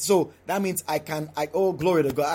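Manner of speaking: ordinary delivery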